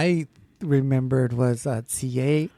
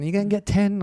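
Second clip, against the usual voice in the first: put-on English accent; 6 semitones higher than usual. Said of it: "He called it tin".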